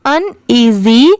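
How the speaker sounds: Uneasy slowly as un, then easy; slow two-part un-easy for uneasy; syllables put together. Shown as a demonstration. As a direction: break uneasy after un, drawing it out. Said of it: "पूरा मिला देंगे".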